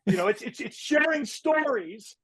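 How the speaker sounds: halting, over-the-top actor impression with pitch swoops; Nick Cage. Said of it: "your Nick Cage impression is probably the best".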